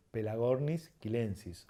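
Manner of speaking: "quilensis" said as kee-LEN-sis. Shown as a demonstration. Teacher giving the strict Latin, hard k in "quilensis". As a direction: say "Pelagornis quilensis" with k, never ch. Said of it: "Entonces tenemos que decir".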